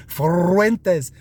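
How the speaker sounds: mock Spanish "Fuentes" with a long trilled R; rolled R. Mimicking Phoenix speakers who let you know, hammed-up rolled R in "Fuentes". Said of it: "they really roll that R".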